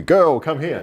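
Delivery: high, loud mock summons; imitating a loud call. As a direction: call her over like a gruff boss. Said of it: "you would have probably".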